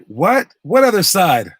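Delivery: high, loud incredulous question; retold disbelief. Brother reacts to his swimming rival's claim, incredulous.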